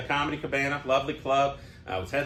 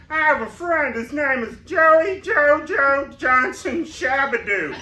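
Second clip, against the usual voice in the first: high, loud cartoon drunk impression; loud, high-pitched cartoon voice; Barney from The Simpsons; 11 semitones higher, 7 dB louder, slower than usual. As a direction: belt it out as a cartoon character, stretching the silly name. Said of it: "where Barney says".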